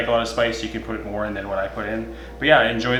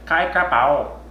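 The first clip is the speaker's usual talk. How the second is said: Kai crap out said higher and louder in a put-on accent; playful louder accented Kai crap out; mock accent; 6 semitones higher, 6 dB louder, much slower than usual. Shown as a demonstration. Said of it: "You gotta say it like that, same, that little accent".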